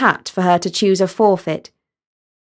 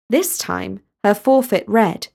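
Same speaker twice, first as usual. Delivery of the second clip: ordinary delivery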